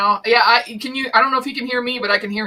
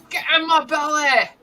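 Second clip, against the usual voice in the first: higher, unshouted second go at the catchphrase; Fat Bastard impression; 6 semitones higher than usual. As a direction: repeat the line at normal volume in the put-on voice. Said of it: "Is that fat bastard?".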